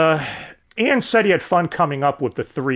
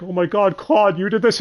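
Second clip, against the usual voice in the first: gushing delight, pitched up; really happy; 5 semitones higher than usual. Acted. Alice thanks Claude for the flowers, really happy. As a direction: act out a happy, grateful master. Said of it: "he's really happy".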